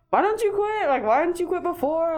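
high, loud disbelieving question; incredulous coworkers. Retold or imitated. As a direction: ask in shocked disbelief. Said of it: "they were like".

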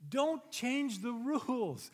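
each word slow and weighted; slow, stressed warning; slow emphatic. Acted. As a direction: lay down the rule slowly. Preacher stressing key rule.

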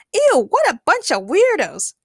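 high, slow, loud eww; disgusted. A narrator's reading. Harley remarks on the masked group, disgusted. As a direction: draw it out in high-pitched disgust.

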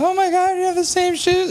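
imitated tipsy girlfriend, very high voice; drunk woman. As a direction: mimic a drunk woman gushing in a high squeal. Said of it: "Like, she's so lit".

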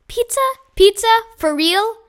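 high, rising, thrilled questions; can't believe the good news; excited disbelief. A narrator's reading. Kids react to the pizza surprise, high and thrilled.

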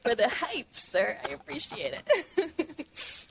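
slow, high, laughing thank you. Real, not acted. Thanking for the hype.

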